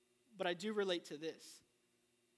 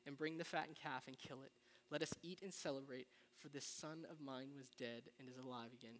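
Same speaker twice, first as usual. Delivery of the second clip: measured scripture reading, slowing at the start; solemn Bible reading to the congregation; 4 semitones lower, 4 dB quieter than usual. Acted. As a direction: read the verses out with weight, a touch softer.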